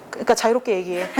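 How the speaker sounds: ordinary talk, nothing marked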